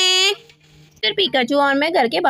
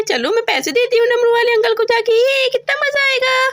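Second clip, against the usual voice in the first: put-on high, thrilled child voice; a kid who just found treasure; high childish glee, a sung "वाह"; excited little girl; 6 semitones higher than usual. Child finds money, excited.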